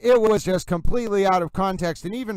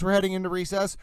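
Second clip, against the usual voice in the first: usual speaking voice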